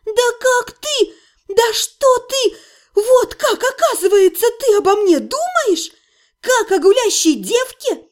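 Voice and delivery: high, choked indignant outburst; breathless outrage. A narrator's reading. Heroine insulted by Otto, choking with outrage. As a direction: splutter with hurt outrage. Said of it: "Я задохнулась от возмущения".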